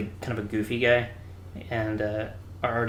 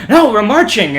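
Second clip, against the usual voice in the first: very loud, high mock reprimand; hard chief's yell; 10 semitones higher, 14 dB louder than usual. Chief to recruit who can't march, mock angry yell. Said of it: "our chief was trying to be hard on him".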